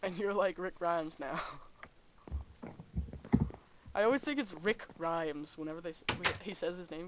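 normal talking voice

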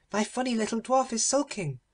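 high, petulant princess call; a spoilt princess calling to her uncle; cried out. Acted. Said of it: "she cried".

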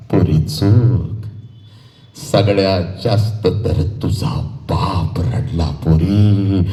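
very slow, low, drawn-out words; grave emotional oration. Acted. Orator tells daughter father cried unseen, grave and slow. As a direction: drop low and draw out every phrase.

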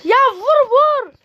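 high, slow desperate yell; frustrated yell. Real, not acted. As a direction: yell it at the screen, drawn out. Oyunda çaresizce bağırıyor. (yüksek sesle çaresiz bağırış)

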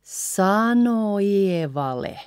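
slow sano Ievalle, joined without the N; drawn-out linked sano Ievalle; N dropped. Shown as a demonstration. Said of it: "Because it's so fast again".

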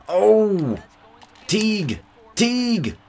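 soaring high, loud yells of a name; excited shouting. Real, not acted. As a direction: scream the name in disbelief at the play. Cheering a big play.

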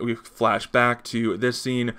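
usual speaking voice

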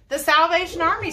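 lightly voiced woman, high and loud; a hint of a woman calling out an idea; woman suggesting. A narrator's reading. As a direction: pipe up from the crowd with a suggestion. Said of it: "suggested a woman".

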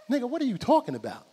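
high, loud incredulous question; exasperated bafflement. Retold or imitated. Hyped uncle finding nobody to fight, loudly baffled. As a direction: demand an explanation, worked up.

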